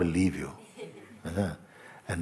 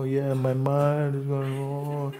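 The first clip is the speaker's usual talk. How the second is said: higher, slow, put-on moan about "my mind"; mock mind talk; 5 semitones higher, 5 dB quieter, slower than usual. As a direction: drag it out like a self-absorbed complainer. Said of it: "you talk like that".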